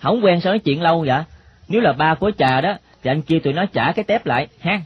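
put-on quick, lightly boyish questioning; pressing boy.

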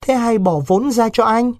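put-on a frightened girl begging her lover to stay; pleading young girl. A narrator's reading. Vịnh begging Đối not to go to Laos, high and pleading.

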